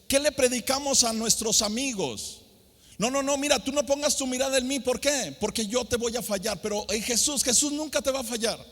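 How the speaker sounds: mock what believers tell friends, then preach; a preacher mocking a common saying; fervent preaching. Preaching at full force.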